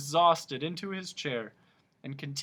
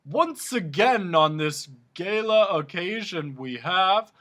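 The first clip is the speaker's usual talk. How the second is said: louder, slow oration; grand king speech; royal address; 6 semitones higher, 9 dB louder, slower than usual. A narrator's reading. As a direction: keep orating to the hall. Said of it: "his voice echoing".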